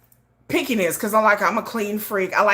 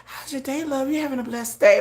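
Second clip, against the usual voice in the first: much softer, tender morning coo; sweet soft greeting; 6 dB quieter than usual. Retold or imitated. Soft tender greeting to her man each morning. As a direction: greet him gently like a sweet partner. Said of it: "I'll be up here talking about".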